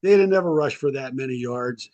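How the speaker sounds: cocky brag, high start; boastful player. Retold or imitated. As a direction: show off in front of the team. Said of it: "Meager stands up in the middle of the meeting and goes".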